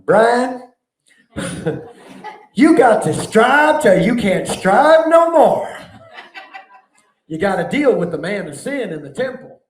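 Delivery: put-on loud, high Alabama church-mother accent, laughing through it. An Alabama mother of the church exhorting the preacher, in a loud Alabama accent. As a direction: belt it out like a Southern Alabama mama preacher. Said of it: "with her Alabama accent".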